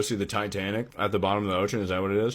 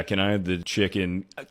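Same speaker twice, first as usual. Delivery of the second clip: usual speaking voice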